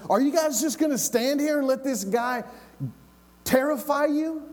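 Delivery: high-pitched, indignant question; incredulous challenge. Acted. David challenges the frightened soldiers.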